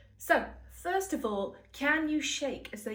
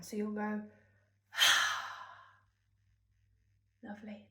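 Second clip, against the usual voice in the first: demo sigh; 3 semitones lower than usual. Shown as a demonstration. Teacher showing kids the shoulder sigh. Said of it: "can you sigh them down?".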